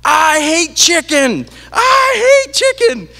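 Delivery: put-on very high, loud, slow child's scream; imitating a kid screaming in a tantrum. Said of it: "he started screaming".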